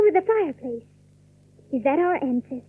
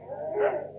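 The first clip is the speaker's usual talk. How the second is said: startled gasp; 2 semitones higher, 8 dB quieter than usual. Acted. Phoebe wakes in fright.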